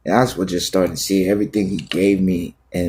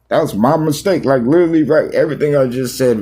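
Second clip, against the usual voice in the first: much higher, louder realization; sudden excited outburst; 7 semitones higher, 4 dB louder, faster than usual. Real, not acted. Pastor stunned that his own words came true, excited outburst. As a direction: burst out as it dawns on you.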